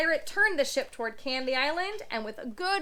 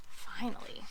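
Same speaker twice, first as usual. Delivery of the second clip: whispered aside; 3 semitones lower, 7 dB quieter than usual. Real, not acted. Narrator aside, relieved.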